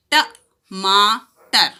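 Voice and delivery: टमाटर slow, loud, syllable by syllable. Shown as a demonstration. Teacher spelling out टमाटर slowly for children. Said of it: "में कोई मात्रा की आवाज़ नहीं है".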